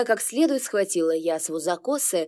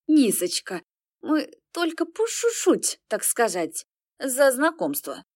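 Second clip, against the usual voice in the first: tipsy giggly mother voice; slurring a little, drunk; 4 semitones higher than usual. A narrator's reading. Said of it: "пьяно захихикала".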